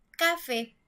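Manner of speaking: café mis-stressed as "cáfe", weight on "ca"; stress on first syllable. Shown as a demonstration. Teacher showing café the wrong way, first syllable stressed. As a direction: hit "ca" and drop "fe" in café. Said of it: "nosotros diríamos".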